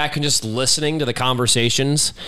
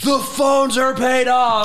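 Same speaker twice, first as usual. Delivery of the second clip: high, loud, stretched-out imitation cheer; hammed-up yell of huge news; mock excited shout; 12 semitones higher, 5 dB louder, much slower than usual. Wife announcing the phone bill news, mock excited shout. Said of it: "She's so excited to text me".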